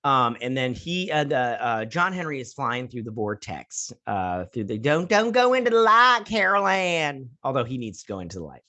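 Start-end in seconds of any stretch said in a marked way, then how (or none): 4.8-7.3 put-on high, loud movie-quote Carol Ann accent. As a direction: wail it in the Carol Ann accent, stretching the name. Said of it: "maybe because of the Carol Ann, uh, accent".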